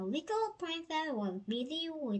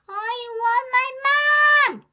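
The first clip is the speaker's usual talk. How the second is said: high, belted, drawn-out wail; loud cry; 12 semitones higher, 16 dB louder than usual. A narrator's reading. Little princess with banged knees, loud wailing cry. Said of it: "cried the little princess".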